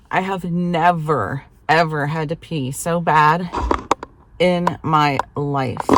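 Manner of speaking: slow, weighted exaggeration, word by word; drawn-out, emphatic delivery; emphatic disbelief. Real, not acted. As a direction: spell out the agony slowly and firmly. Emphatic account of desperate full bladder.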